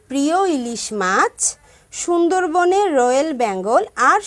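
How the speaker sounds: put-on clear, rhythmic children's rhyme recital; measured chant of a children's verse; rhyme recitation. Shown as a demonstration. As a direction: recite it crisply for the class.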